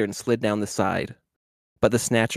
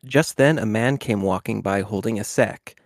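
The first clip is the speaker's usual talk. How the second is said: usual speaking voice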